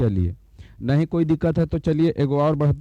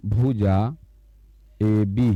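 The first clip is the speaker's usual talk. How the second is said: slow reading aloud; 5 semitones lower, much slower than usual. Shown as a demonstration. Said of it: "इसको पढ़ेंगे".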